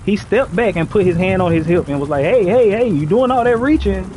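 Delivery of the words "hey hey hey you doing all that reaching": higher, louder cop snapping a warning; mock cop calling hey hey hey sharply; retold cop raising his voice; alarmed cop.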